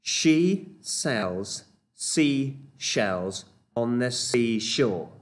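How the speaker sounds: She sells seashells stretched out very slowly; slow demo. Shown as a demonstration. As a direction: lay out each word slowly. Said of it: "here's our next tongue twister".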